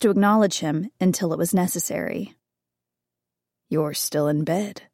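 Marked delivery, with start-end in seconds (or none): 3.7-4.8 low, slow, menacing husband; cold low voice of a controlling husband; husband's low voice. A narrator's reading. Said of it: "Colin observed".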